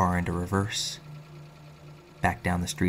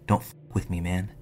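ordinary delivery, nothing marked

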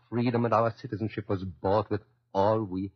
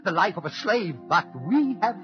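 rising defiance; 5 semitones higher than usual. Acted. Immigrant defying Hitler, building passionate outrage. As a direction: defy him more fiercely with each line.

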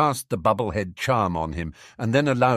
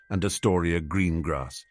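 usual speaking voice

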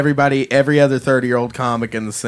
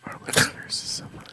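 soft ASMR whisper; 12 dB quieter than usual. Pretend. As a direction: whisper it to the listeners. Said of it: "Do some A S".

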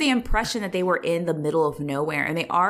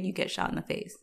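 quiet; 10 dB quieter than usual. Real, not acted.

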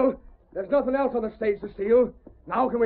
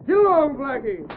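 driving horses; 3 dB louder than usual. Acted. Stage driver urges Blackie on, calling out. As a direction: call out to the team to move.

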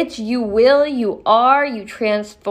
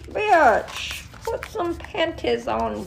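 higher, put-on scolding friend; mock scolding voice; 6 semitones higher, slower than usual. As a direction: scold yourself in a higher comic voice.

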